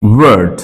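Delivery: ওয়ার্ড with a brief vowel before the R; short R-controlled vowel. Shown as a demonstration. Teacher gives example ওয়ার্ড, short vowel. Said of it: "একেবারে সংক্ষিপ্ত".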